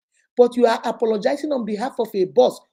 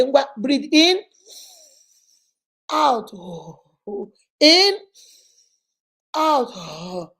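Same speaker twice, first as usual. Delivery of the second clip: mock controlling orders; 2 semitones higher, much slower than usual. Partner dictates breathing.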